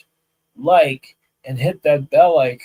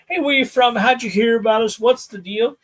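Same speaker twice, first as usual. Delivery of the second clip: upbeat greeting; 9 semitones higher, 5 dB louder than usual. Retold or imitated. Greeting students, retold.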